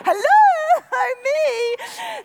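loud, high comic outburst; mock indignation. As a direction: cry out as the overlooked mum. Mock-indignant mum hinting at herself.

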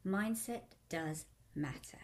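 ordinary talk, nothing marked

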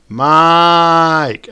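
high, loud call across a store. A narrator's reading. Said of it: "a loud elderly voice called across the store".

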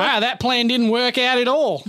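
a playful, jokey Aussie twang at the co-hosts; mock Australian accent. Joking with co-hosts in a mock Australian accent. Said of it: "Do your Australian accent".

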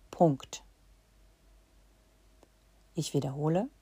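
ordinary delivery

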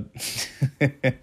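soft, breathy run of chuckles; amused chuckle. Real, not acted. Reviewer recalling a cartoon's silly songs, amused chuckle. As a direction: chuckle quietly to yourself.

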